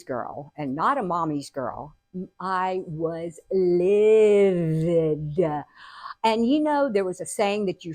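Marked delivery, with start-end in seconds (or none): 2.4-5.4 LIVID stretched out long and slow; slow, heavy weight on livid; drawn-out emphasis. Retold or imitated. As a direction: drag out "livid" for dramatic effect. Stressing how angry she was.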